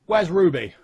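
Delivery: loud, high demand; calling out. Acted. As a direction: call after someone walking off. Sheriff calls after man leaving.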